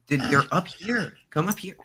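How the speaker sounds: high-pitched coaxing to a cat; coaxing a pet. Real, not acted. Streamer calling the cat up to the treats, high and coaxing.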